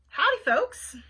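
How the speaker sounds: high, loud Southern waitress greeting; lightly voiced Southern diner waitress; deep Southern accent. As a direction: call it out with a Southern twang. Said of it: "she said in a deep Southern accent".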